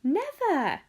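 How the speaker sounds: high, emphatic denial; cried out. A narrator's reading. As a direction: cry out a drawn-out refusal. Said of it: "cried Mister Wonka".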